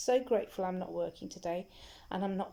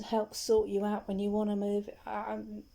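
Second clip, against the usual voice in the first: normal talking voice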